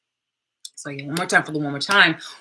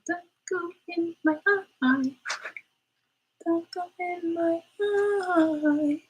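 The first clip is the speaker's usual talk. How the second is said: much higher, drawn-out pretend pleading; pleading little voice; 12 semitones higher, slower than usual. Fighting fluff in her face, she pleads in a high pretend voice. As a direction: whine it slow like a tiny creature begging. Talks baby voice while fluffies bother.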